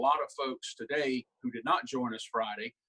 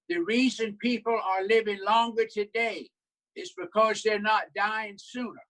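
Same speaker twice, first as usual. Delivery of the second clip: higher, old Southern senator impression; Strom Thurmond voice; 6 semitones higher than usual. Senator speaking on the Senate floor, higher old Southern senator voice. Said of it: "the way he would say it".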